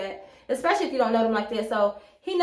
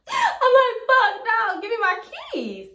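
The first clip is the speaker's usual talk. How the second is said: laughing; 9 semitones higher than usual. Retold or imitated. Laughing while retelling panic.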